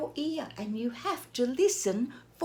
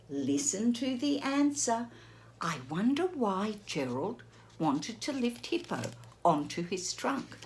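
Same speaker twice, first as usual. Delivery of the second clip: plain reading voice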